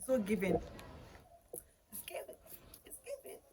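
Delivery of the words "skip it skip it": a quick hushed aside, skip it; whispered aside. Real, not acted. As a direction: whisper it under your breath.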